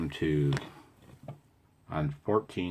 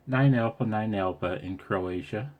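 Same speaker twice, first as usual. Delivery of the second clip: ordinary talk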